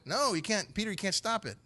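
mock-exasperated correction; emphatic contradiction. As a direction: cut down the objection, pitched high. Preacher argues back at Peter.

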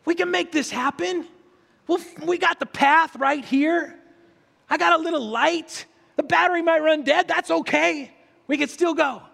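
high, eager pep talk; encouraging. Pretend. Imagined Brent cheering Andrew up the dark path, high and eager. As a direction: cheer a struggling friend along. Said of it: "He's going".

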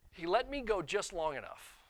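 normal talking voice

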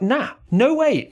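slow, high nah, no way; exasperated emphatic refusal. Retold or imitated. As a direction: draw the line firmly. Retold refusal to stand for it.

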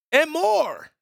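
high-pitched, drawn-out tag; playful announcer flourish. Pretend. Host closing his plug to listeners, high flourish. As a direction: fling it up high and hold it. Sing-song end of contact plug.

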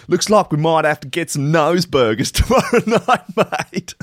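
reading a silly comment aloud, laughing as a mock Aussie. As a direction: giggle your way through the joke. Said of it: "many users gave ... thumbs up".

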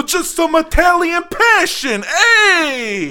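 Italian shout. Acted. Mock Italian passion, shouting.

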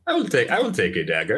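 usual speaking voice

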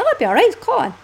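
high, airy mock reassurance; breezy confidence. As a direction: brush it off like it can't go wrong. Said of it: "And I was like".

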